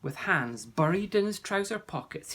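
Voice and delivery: usual speaking voice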